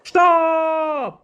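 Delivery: very slow, loud "stop"; loud, stretched stop command; drawn-out stop. Acted. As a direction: cut them off firmly. Officer halts singing.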